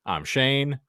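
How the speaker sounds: ordinary talk, nothing marked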